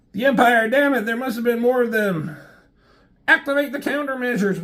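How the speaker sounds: hammed-up alien shrieking orders; panicky alien. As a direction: squeal it like a frantic little alien.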